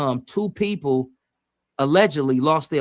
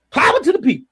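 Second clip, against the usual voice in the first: put-on quoting old girl; 11 semitones higher, 5 dB louder, faster than usual. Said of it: "like old girl say".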